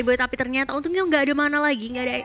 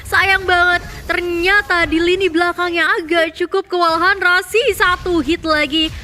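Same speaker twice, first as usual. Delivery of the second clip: loud, high hype play-by-play; esports caster; 6 semitones higher, 7 dB louder than usual. Acted. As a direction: shout the action as it unfolds. Caster calls teamfight excitedly.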